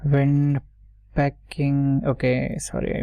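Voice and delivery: usual speaking voice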